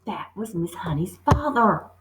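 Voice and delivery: usual speaking voice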